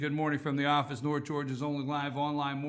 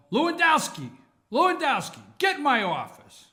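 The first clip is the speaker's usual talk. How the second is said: higher, louder call into the office; hammed-up summons to an employee; mock boss summons; 8 semitones higher, 5 dB louder than usual. Boss calls Lewandowski in to be fired, mock summons.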